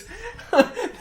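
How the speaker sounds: sharp high giggle; squeaky burst of laughter; high delighted laugh. Real, not acted. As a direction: laugh in pure disbelief. Laughing at great play.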